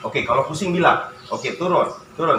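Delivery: usual speaking voice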